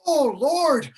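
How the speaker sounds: milk every ache, wailing over head and back; aching. Acted. Nurse complaining of head and back, hammed-up aching. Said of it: "how my head aches".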